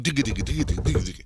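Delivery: rapid-fire imitated thumping; racing heartbeat imitation. Host imitating his heart on the stress test, racing. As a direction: patter out a pounding heart. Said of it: "my heart just starts going".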